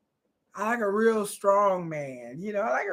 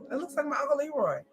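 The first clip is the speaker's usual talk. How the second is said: quick aside; 4 semitones higher, 8 dB quieter, faster than usual. Real, not acted. Dragon teeth joke.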